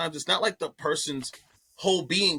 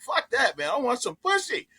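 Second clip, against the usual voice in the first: higher, lightly voiced buddy brushing it off; imitating horny friend; 9 semitones higher than usual. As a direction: toss it off like a carefree teenage friend. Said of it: "He's like".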